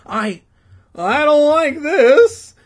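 high, loud, drawn-out fearful wail; stammer, then wail it out in alarm; hammed-up frightened boy; scared stammer. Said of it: "Danny stammered".

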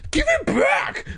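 high, strained angry cry; angry insistence. A narrator's reading. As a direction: demand it back in an angry outburst. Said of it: "Greg insisted angrily".

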